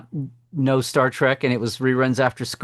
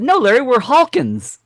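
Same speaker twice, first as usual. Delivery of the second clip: teacher's reply; 9 semitones higher, 5 dB louder than usual. Retold or imitated. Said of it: "she said".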